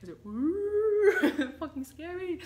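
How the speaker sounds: high, loud startled oeh; real giddy excitement; excited gasp. Real, not acted. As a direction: react with thrilled awe. Excited awe at energy.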